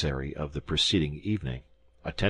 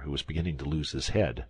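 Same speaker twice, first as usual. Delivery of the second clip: usual speaking voice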